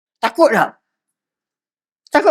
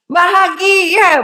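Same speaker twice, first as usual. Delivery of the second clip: bahagia stretched long, higher and louder; drawn-out emphasis; 6 semitones higher, 3 dB louder, much slower than usual. Real, not acted. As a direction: linger on bahagia to make it felt. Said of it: "drawn-out key word". (bahagia disebut panjang, tinggi dan kuat)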